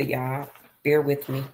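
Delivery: normal talking voice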